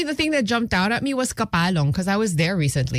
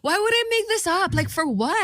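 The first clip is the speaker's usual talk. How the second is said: indignant disbelief; 11 semitones higher than usual. Real, not acted. Defending herself against teasing.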